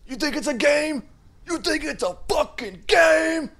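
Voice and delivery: high, loud imitation rap scream. Imitating the song in his head, high rapper scream. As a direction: scream it like a rap hook, drawing out the end. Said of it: "just the way he screams".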